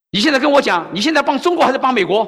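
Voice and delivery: loud, high, furious demand; angry shout. Retold or imitated. Old veteran shouting at the pastor over China, furious. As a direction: bark the question at him like an accusation.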